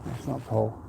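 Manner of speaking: a low, soft, defeated repeat; hushed and upset, barely voiced; quiet. Real, not acted. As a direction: let it sink away, almost to yourself. Repeating the point, upset, trailing off.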